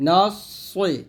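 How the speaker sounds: "না সই" with a thick, held s; "না সই" with an emphatic s; heavy s. Shown as a demonstration. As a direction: hold a full, rounded s in "সই". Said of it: "মোটা করে ধরতে হবে".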